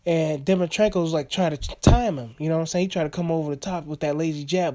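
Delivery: normal talking voice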